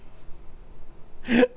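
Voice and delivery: soft wheezy laugh; breathless giggle. Real, not acted. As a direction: crack up at your own shouting. Cracks up at own impression.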